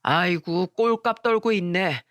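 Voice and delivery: slow, drawn-out mocking jibe; sneering sarcasm. A narrator's reading. Taxi driver butts in on passengers, sneering. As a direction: sneer it with a scoffing edge. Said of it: "비아냥거리면서 말했어요".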